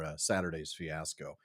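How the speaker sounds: ordinary talk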